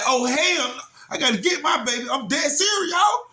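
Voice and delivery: high-pitched urgent exclamation; retold alarm. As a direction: relive the rising alarm, climbing in pitch. Retells alarm at daughter in danger.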